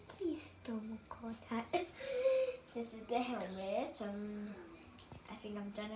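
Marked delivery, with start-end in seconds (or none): 1.9-2.7 bursts into a high giggle. Real, not acted. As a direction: giggle at the silly word. Giggle after belly button.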